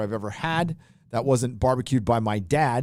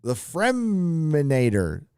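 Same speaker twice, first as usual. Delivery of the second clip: dramatic announcer; 5 semitones higher, much slower than usual. Pretend. Reading a donor name grandly.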